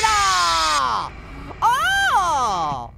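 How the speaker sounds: long, drawn-out yell of shock; sustained startled holler; startled scream. Real, not acted. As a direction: scream "oh" as something leaps out. Jumpscare while searching the dark.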